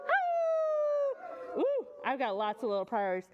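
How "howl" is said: long high howl; high drawn-out coyote howl. Shown as a demonstration. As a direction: howl up at the sky. Teacher howls in song.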